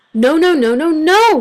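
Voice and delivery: shouting. A narrator's reading. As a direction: yell it as a stamping group. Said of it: "shouted the group".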